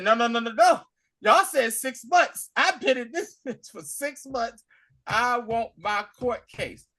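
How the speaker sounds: loud, high acted-out outrage; indignant protest. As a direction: protest at the top of the voice. Acting out angry demand, retold.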